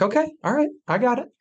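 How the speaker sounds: squeaky high backing-down voice; high mock officer. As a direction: pitch way up, caught out.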